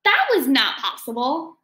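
plain reading voice

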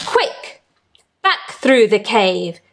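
ordinary delivery, nothing marked

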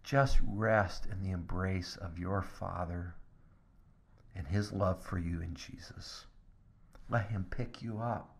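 hushed, gentle, near a whisper; soft tender sermon voice. Real, not acted. Pastor comforting the congregation, hushed and tender.

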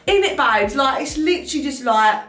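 full Essex babe rant; Essex accent. Pretend.

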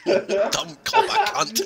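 repeating while laughing. Real, not acted. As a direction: laugh through the words.

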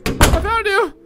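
high, loud burst; creepy monster call. Acted. Monster finds hiding Fluffy, creepy loud call. Monster's line in acted dialogue.

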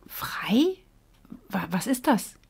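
breathy wondering question; unsure what the word means; puzzled whisper. Acted. The little fish asks Bo, puzzled and hushed. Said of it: "fish puzzled by the word".

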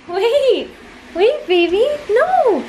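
very high, pleading coax; coaxing puppy talk. Real, not acted. Owner coaxing scared puppy into first bath, very high. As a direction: chase the pup with high sweet calls.

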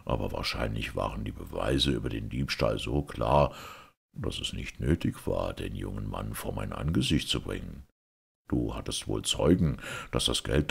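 low, measured ruler's voice; composed Caliph. A narrator's reading. Caliph questioning the merchant about witnesses, composed and low. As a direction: question calmly from authority, pitched low.

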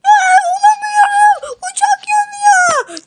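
yüksek, yavaş feryat; çaresizce uzatarak bağır; panik. Acted. (uçağı yanan Ahmet çaresizce feryat ediyor)

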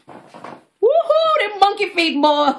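high, gleeful woohoo; giddy high-pitched cheer; excited whoop. Real, not acted. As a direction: squeal with delight at the trick. Excited about picking up with toe.

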